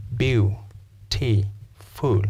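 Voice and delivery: "beautiful" broken into three separate beats, Beau, T, full; syllable by syllable. Shown as a demonstration. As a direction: pause after each syllable of Beau-T-full. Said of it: "Your jaw drops three times".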